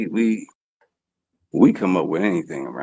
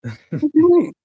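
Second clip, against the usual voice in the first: put-on high, surprised, as if he has no accent; mock baffled reply; 14 semitones higher than usual. Asked about his Philly accent, high mock surprise. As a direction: act puzzled at the question. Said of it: "I'm like".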